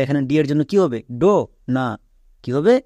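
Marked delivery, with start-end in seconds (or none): none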